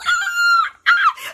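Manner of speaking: high, loud squeals of joy; co-star roles and a burst of squealing; real excited shriek. Real, not acted. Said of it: "I'm getting excited".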